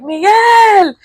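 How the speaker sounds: high, drawn-out name call. Retold or imitated. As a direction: imitate calling across to him. Retold calling out to Miguel.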